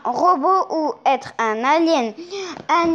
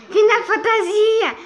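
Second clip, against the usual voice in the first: excited recognition; 7 semitones higher than usual. Real, not acted. Recognizing the title with a laugh.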